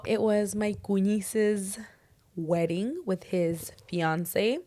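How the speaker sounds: ordinary delivery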